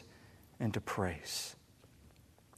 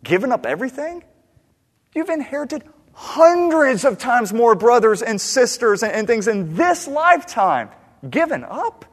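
high, loud disbelief, questions shooting upward; incredulous retort; 11 semitones higher, 10 dB louder than usual. Acted. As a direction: throw it back at him, loudly astonished. Voices Jesus incredulous at Peter.

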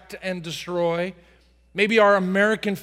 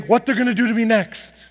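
mock fearfulness; 4 semitones higher, 5 dB louder than usual. Afraid of demons.

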